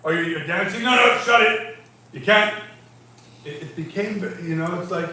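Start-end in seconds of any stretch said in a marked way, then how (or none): 0.0-2.5 louder, higher play-acted scolding; hammed-up killjoy commands; mock bossy orders. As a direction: order them about in mock strictness. Acting out being ordered to cry.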